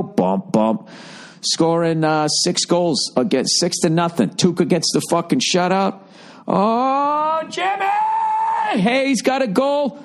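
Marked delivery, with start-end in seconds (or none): 0.0-0.9 low, short thumping bump bump; deep mock bass thump; imitated bump sound. 6.5-10.0 high shouted Jimmy call; excited yell. Retold or imitated.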